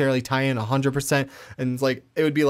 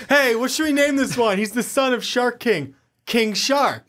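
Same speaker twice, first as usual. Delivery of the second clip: mock comic writer; 10 semitones higher than usual. Mocking comic writers naming character.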